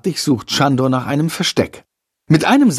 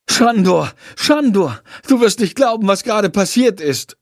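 high-pitched, excited calling; 8 semitones higher than usual. Acted. Jendrik rushing into his room to Shandor, excited. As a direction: burst out with urgent news. Boy bursts in with news.

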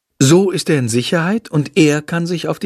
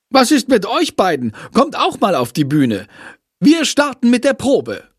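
high, raised scolding; scolding teacher; 11 semitones higher than usual. A narrator's reading. Teacher scolding two pupils to the stage, high and cross. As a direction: tell them off, much higher. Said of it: "schimpft Frau Schmidt".

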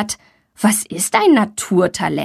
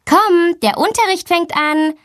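high-pitched call, only mildly raised; 9 semitones higher than usual. A narrator's reading. Teacher calling a late pupil to class, calling out. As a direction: beckon from down the corridor. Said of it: "ruft sie".

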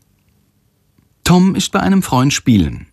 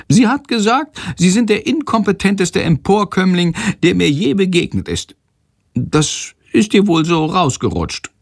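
high figure voice quoting an insult; chatty Sándor, gossiping; 10 semitones higher than usual. A narrator's reading. Sándor quotes the teacher. (hoch und genüsslich weitererzählt)

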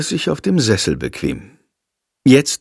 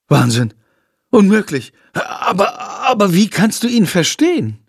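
bursting out; 7 semitones higher than usual. Acted. Girl amazed the boy understands the bat, bursting out. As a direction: let the astonishment burst out. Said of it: "Aus Lilly platzt es heraus".

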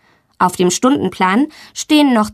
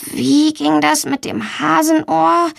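slow, higher, thinking to herself; pondering; 6 semitones higher than usual. A narrator's reading. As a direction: puzzle it out slowly. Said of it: "überlegt sie". (grübelnd, langsam und hoch)

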